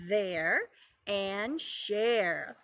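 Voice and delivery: slow, separate "There" and "share"; rhyme sounded out. Shown as a demonstration. Teacher repeating the rhyme for preschoolers, rhyme sounded out. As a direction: draw out "There and share" with gaps, falling at the end. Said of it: "did you hear that rhyming".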